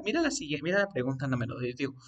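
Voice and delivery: ordinary talk, nothing marked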